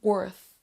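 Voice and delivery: WORTH ending with tongue on upper teeth; careful final 'th' on WORTH; correct TH. Shown as a demonstration. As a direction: say WORTH with TH, never an S. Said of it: "la langue va aller au niveau des dents".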